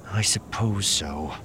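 slow, grudging assent; reluctant agreement. A narrator's reading. Mara giving in to Luke's bluff plan, reluctant. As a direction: give in slowly, unwillingly. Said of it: "Mara's lip twisted".

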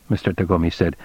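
ordinary delivery, nothing marked

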